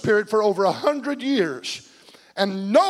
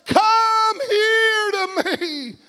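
very high, drawn-out yell; screaming plea; 10 semitones higher, much slower than usual. Acted. Preacher as God screaming for the lost to come, long high yell. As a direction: stretch every word into a long, pleading holler. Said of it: "He's screaming".

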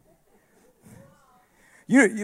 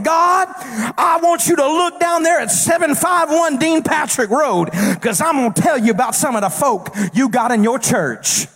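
Satan the accuser; 8 semitones higher than usual. Acted. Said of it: "That's how I know he says it".

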